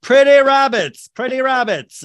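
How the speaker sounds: high, loud, drawn-out simpleton chant; hammed-up dim-witted delight, pitched high; Lenny. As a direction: marvel slowly and loudly like a big child. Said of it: "Like Len-, Lenny from Of Mice and Men".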